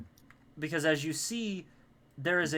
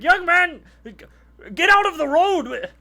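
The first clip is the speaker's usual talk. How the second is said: high, loud yell from a guard; guard shouting; 13 dB louder than usual. Acted. Guards to the boy stuck in the road, shouting. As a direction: bellow the order at full volume, pitched high. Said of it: "the guards are shouting at him".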